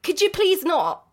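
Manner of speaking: drawn-out, put-upon plea; mock exasperation. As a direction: lightly play an irritated goblin husband. Goblin objects to wife's lullaby.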